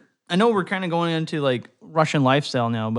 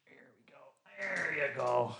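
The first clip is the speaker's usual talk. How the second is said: whisper; 14 dB quieter than usual. Real, not acted. Settling the cat down.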